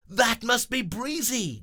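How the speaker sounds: high loud call; excited shout. Acted. Grover shouting as hero appears. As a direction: point up and shout. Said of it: "Grover shouts".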